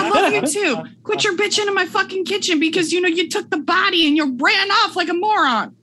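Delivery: high squeaky scolding voice. Acted. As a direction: scold them in a squeaky put-on voice. In-character scolding continues.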